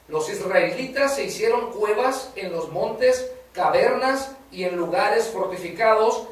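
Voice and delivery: slow, loud, solemn Bible reading; preacher reading the passage to the church in a slow, solemn, proclaiming voice; scripture reading. Acted. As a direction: proclaim the verses slowly to the congregation. Reads scripture aloud.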